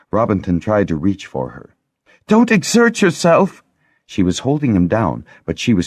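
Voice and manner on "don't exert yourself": much higher, anxious urging; Lessa urging. A narrator's reading. Lessa keeping the Harper still, high and anxious. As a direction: hold him back with your voice.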